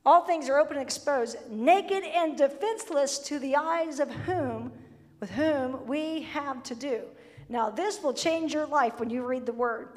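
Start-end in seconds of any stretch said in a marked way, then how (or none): 0.0-7.0 slow, solemn reading; slow solemn scripture. Acted. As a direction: proclaim the verse slowly.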